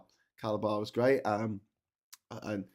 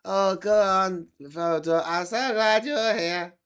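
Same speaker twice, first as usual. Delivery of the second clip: much higher, slow squeaky Frodo-style voice; helium hobbit voice; 8 semitones higher, 8 dB louder, slower than usual. Pretend. Vlogger acting a hobbit scene, high squeaky voice. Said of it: "No one thought of that".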